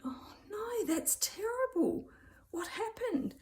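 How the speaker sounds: high, shocked oh no; shocked sympathy. Retold or imitated. To the classmate hearing her news, high shocked sympathy. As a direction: gasp of concern, voice up high.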